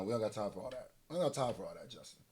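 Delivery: low, soft brush-off; quiet dismissal. Real, not acted. Waving off the intro, muttered repeats.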